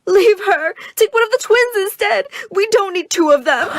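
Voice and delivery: high, loud hammed-up sobbing mom; mock wailing plea. Mama wailing to the robbers, hammed-up high crying plea. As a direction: wail it to the robbers, half laughing. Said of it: "Mama started to cry loudly".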